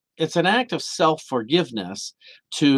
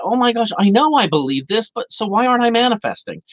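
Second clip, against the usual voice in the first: high, frustrated questioning; pitched-up frustrated inner voice; exasperated; 9 semitones higher than usual. Retold or imitated. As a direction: throw up your hands in disbelief. Voicing a frustrated believer.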